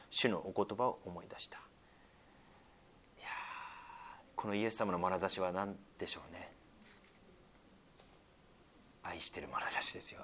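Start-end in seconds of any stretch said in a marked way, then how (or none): 1.6-10.2 soft, lower, hushed wonder; awed whisper. Acted. Preacher marveling at Jesus' loving look, soft and hushed. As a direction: let it fall quiet in awe.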